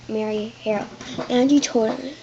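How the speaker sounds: ordinary delivery, nothing marked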